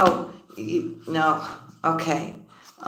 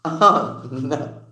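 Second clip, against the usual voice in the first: ordinary delivery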